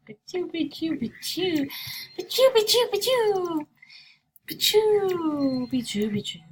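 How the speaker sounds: high, sing-song fake sneezing; playful mock sneezes. Streamer faking sneezes after saying she must sneeze. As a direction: sneeze in a bouncy rhythm, playfully. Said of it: "I gotta sneeze so bad".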